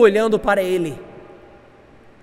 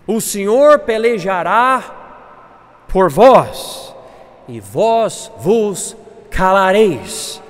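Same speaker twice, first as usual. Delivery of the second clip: slow, weighty declaration, building in volume; scripture proclamation; 6 dB louder, much slower than usual. Acted. Moisés promising the Lord will fight, proclaiming slowly. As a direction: stretch each phrase and let it ring. Reads verse fourteen as proclamation.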